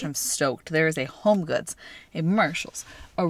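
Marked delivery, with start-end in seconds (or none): none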